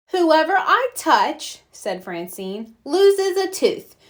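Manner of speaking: high, slow, grand fairy pronouncement; tooth fairy game voice. Acted. Francine playing Tooth Fairy at recess, grandly casting a spell. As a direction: cast a spell on the playground.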